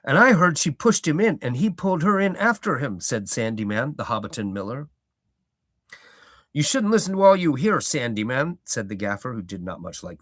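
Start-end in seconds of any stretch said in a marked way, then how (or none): 0.0-3.0 higher, louder gossiping miller; Sandy Man. Acted. 6.5-8.6 put-on higher, louder reproving old gardener; Gaffer rebuking. Gaffer scolding.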